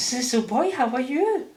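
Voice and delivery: high mimicked French accent; Isabel's French accent. Wife greeting the little boy, imitated French accent. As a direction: imitate a Frenchwoman's English, pitched well up. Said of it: "love it when he does Mummy's accent".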